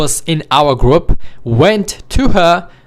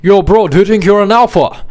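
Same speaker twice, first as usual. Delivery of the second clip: put-on drunk guy yelling; 4 semitones higher than usual. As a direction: shout it like the drunk guard. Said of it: "I think he was yelling".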